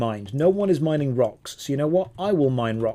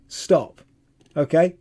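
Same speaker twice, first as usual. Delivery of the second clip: usual speaking voice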